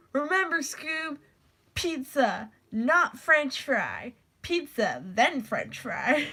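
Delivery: slow, lightly voiced cartoon character; character reading. Shown as a demonstration. Reading a kids' Scooby-Doo book, lightly voiced and slow. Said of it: "a little bit of character in it".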